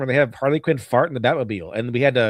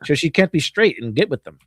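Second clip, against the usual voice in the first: higher, prissy mock-serious scolding; sarcastic impression of a preachy studio; mocking the developers; 6 semitones higher than usual. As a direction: parody a preachy writer, voice pitched up.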